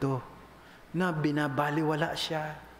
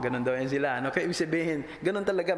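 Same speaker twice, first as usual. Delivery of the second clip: usual speaking voice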